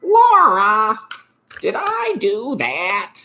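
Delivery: high nerdy sitcom squeak, slow on the question; Steve Oracle impression. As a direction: squeak it like a clumsy sitcom nerd. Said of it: "Or remember Steve Oracle?".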